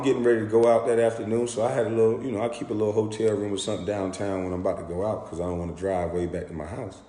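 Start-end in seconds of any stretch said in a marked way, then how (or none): none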